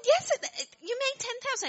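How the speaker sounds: high, loud and eager; insist, bursting with joy; excited insisting. Retold or imitated. John insisting, retold excitedly.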